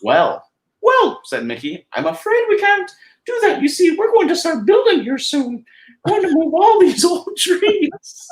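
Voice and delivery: squeaky friendly cartoon mouse; Mickey Mouse falsetto. Mickey explains building plans.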